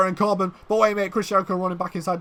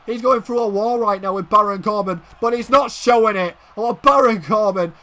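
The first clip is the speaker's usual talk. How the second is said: ordinary delivery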